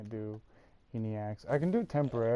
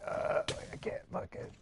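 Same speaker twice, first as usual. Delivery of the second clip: soft, voiceless strain on the words; strained, worn-out voice demo; imitating a wrecked voice; 3 semitones higher, 6 dB quieter than usual. Shown as a demonstration. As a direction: talk as if your vocal cords gave out. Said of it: "I literally sounded like this".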